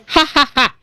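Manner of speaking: head thrown back, stiff "ha, ha, ha"; separate, evenly placed fake laughs; fake belly laugh. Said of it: "puts her head back and goes".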